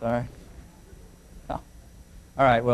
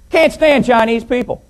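higher, mildly peeved outburst; mock disgruntled; 10 semitones higher, 4 dB louder, slower than usual. Pilot complaining to his substitute co-pilot, mock disgruntled. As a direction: imitate an unhappy grumbler blurting it out. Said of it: "was not happy about it".

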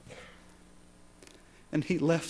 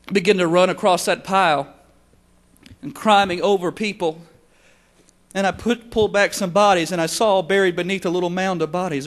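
urgent retelling; 4 semitones higher, 7 dB louder than usual. Retold or imitated. Missionary retells rescuing the boy.

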